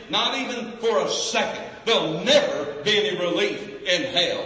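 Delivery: high, loud, slow preacher's cry; preaching at full force. Acted. Preacher warning the congregation about hell, high fervent cry. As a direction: bear down on the congregation, drawing each phrase out.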